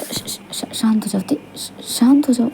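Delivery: soft shushing and gentle words; soothing hush. Acted. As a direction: hush someone who is crying, softly. Hushing the crying girl. (धीरे से चुप कराते हुए)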